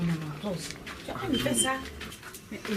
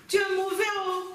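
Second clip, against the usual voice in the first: indignant reproach; 9 semitones higher than usual. Acted. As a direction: reproach him, pitch jumping up. Wife reproaches Béranger at the station.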